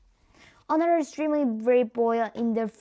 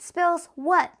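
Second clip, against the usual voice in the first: higher, louder squeal; 5 semitones higher, 5 dB louder than usual. A narrator's reading. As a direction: squeak it out while dangling. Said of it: "he squealed".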